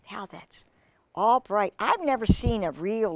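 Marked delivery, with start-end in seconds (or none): 0.1-0.6 whisper. Real, not acted. Reading to a child, whispering over the picture.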